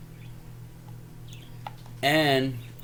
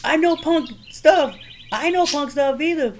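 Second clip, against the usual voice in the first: high-pitched comic movie imitation; hammed-up movie quote; 4 dB louder than usual. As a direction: act it out high and drawn out. Re-enacting a comic movie line.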